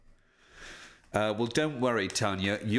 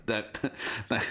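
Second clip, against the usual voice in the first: ordinary talk, nothing marked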